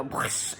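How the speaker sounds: breathy whooshing noise, no voice; rocket whoosh. Retold or imitated. Describing a rocket launched off the pad, whispered whoosh. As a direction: imitate a rocket taking off.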